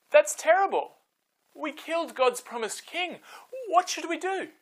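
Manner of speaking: hammed-up panic of a guilty crowd; dismayed crowd. Crowd realizes they killed king.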